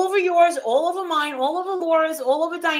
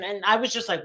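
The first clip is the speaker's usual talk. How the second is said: usual speaking voice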